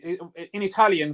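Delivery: ordinary delivery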